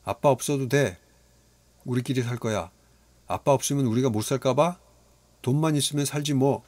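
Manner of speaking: put-on a boy screaming at his father; screaming child. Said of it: "악을 썼다". (악쓰는 아이)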